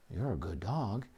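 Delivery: gently reward the dog, keep it low; quiet praise. Shown as a demonstration. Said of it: "Reinforce very quietly".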